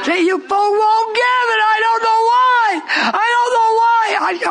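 high, drawn-out preaching wail; exasperated shout. Real, not acted. Preacher to folk who won't give in, anguished high shout. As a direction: cry out loud and high, stretching the last words. Preacher cries out at unresponsive congregation.

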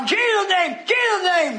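high, ringing cry of rebuke; exorcist's command. Retold or imitated. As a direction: call it out to drive a devil away. Re-enacting commanding a devil out.

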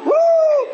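high, loud "woo"; excited whoop. Acted. Excited woman on her way, whooping. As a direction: let out one long high whoop.

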